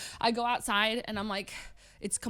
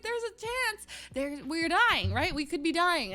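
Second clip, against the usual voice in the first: high-pitched mock panic; freaking out; 8 semitones higher, slower than usual. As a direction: insist in hammed-up alarm, high and urgent. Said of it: "So I'm freaking out".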